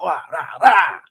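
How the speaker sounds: imitated roar with each punch thrown; Pereira. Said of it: "doing the little impression of Pereira".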